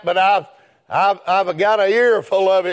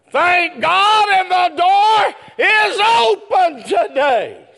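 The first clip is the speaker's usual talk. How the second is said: preaching at full force; 11 semitones higher, slower than usual. Acted. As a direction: ring it out like a revival preacher. Preacher erupts after laugh.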